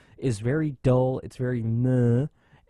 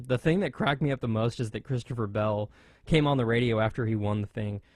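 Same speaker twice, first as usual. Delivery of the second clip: normal talking voice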